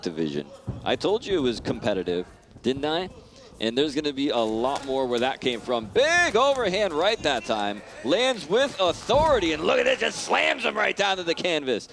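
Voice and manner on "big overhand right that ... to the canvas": rising excited play-by-play. Real, not acted. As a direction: react to a big punch. Knockdown in fight commentary.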